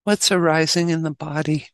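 measured meditation guide with long pauses; unhurried instructions, leaving long silences; guided meditation. Acted. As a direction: guide them gently into stillness, phrase by phrase.

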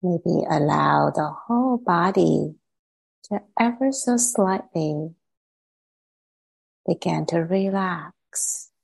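calm, unhurried meditation guide with long pauses; gently measured meditation voice, settling phrase by phrase; guided meditation. Acted. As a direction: ease listeners into relaxing, leaving space after each phrase.

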